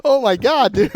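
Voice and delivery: high, loud laughing exclaim. Real, not acted. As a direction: crack up loudly.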